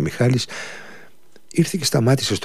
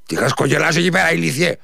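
much higher, louder furious outburst; thundering rage; 11 semitones higher, 4 dB louder than usual. A narrator's reading. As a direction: roar at the grinning apprentice. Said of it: "άστραψε και βρόντιξε ο κύριος". (οργισμένη, βροντερή φωνή)

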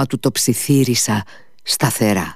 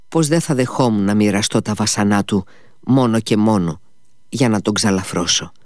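ordinary delivery, nothing marked

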